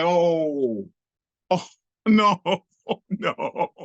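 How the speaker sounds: hammed-up horror; mock dread. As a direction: wail it as comic realization. Retold dread at being set up.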